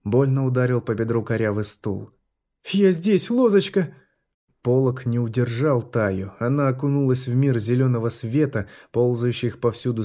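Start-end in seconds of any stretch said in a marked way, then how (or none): 2.7-3.9 calling out. Acted. Mother calling to daughter.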